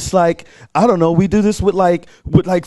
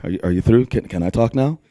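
low-voiced patient question; deep imagined voice of God; God waiting patiently; 6 semitones lower, faster than usual. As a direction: drop the pitch and gently interject. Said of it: "I can feel God being like".

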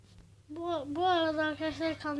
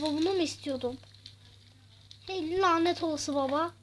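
normal talking voice

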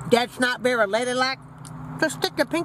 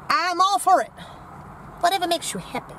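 ordinary talk, nothing marked

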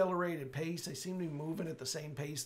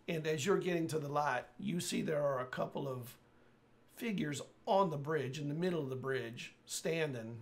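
ordinary talk, nothing marked

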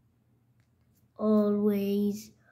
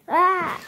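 short, high play scream; startled yelp; 7 semitones higher, 4 dB louder than usual. Pretend. Character is caught in a play fight, short high yelp. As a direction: scream as if under attack.

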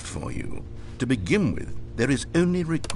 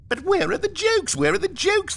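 much higher, nasal-free whine mimicking critics; mocking whiny reviewers. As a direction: parody the complaining fans in a squeaky voice. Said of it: "they bemoaned".

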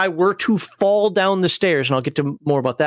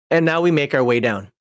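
ordinary delivery

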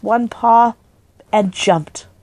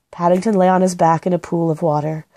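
usual speaking voice

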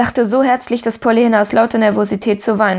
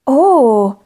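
higher, louder drawn-out oh; delighted cry; 4 semitones higher, 5 dB louder than usual. A narrator's reading. Pollyanna claps at the surprise, delighted cry. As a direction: burst out with surprised joy. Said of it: "rief sie und klatschte in die Hände".

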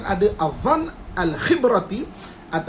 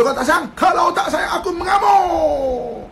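mock tantrum; 7 semitones higher, 4 dB louder than usual. Wife threatens her husband she will go berserk, mock shout. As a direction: threaten to rage, overdone.

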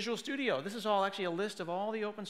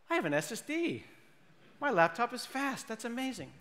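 higher-pitched gleeful marvel; delighted mock wonder; 6 semitones higher than usual. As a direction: wake up thrilled by a new gadget. Waking up delighted with new SSD.

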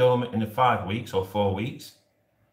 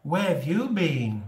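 imitating passengers; 5 semitones higher, slower than usual. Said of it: "everyone on my bus route's like".

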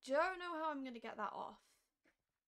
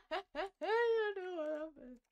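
laughing; 6 semitones higher, 8 dB louder, slower than usual. Real, not acted. Laughing after voice cracked.

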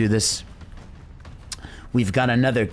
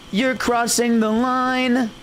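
playful warning; 11 semitones higher, slower than usual. Pretend. Playful line at game.

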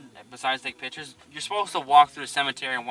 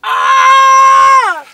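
much higher, louder, piercing shriek; retold scream; 17 dB louder than usual. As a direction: shriek it out in terror. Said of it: "and I just scream".

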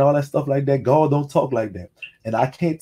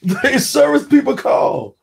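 high, slowed sing-song imitation of a caller; drawn-out mocking call, pitched up; mock insurance caller; 10 semitones higher, 5 dB louder, slower than usual. Mimicking insurance callers, laughing.

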